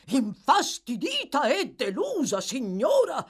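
loud, high indignant echo; exclaiming. A narrator's reading. Bumble reacting to the lady's upset, exclaiming. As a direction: repeat it back, scandalised and loud. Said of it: "esclamò il signor Bumble".

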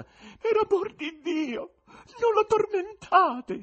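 very high, slow, loud wail; pleading female voice. A narrator's reading. Woman in a box begs for mercy, high pleading cry. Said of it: "si udì da un palco una voce femminile".

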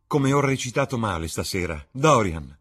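elated actress greeting her love; joyful exclamation. Acted. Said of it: "esclamò". (Sibyl esclama felice verso Dorian)

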